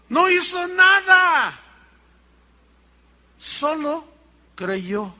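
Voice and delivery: high, loud, slow, drawn-out declaration; emphatic preaching shout. Real, not acted. Preacher answering his own question about the thief, slow shout. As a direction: cry out the answer, stretching each word.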